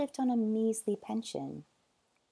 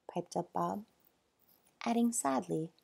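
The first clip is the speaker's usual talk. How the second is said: plain reading voice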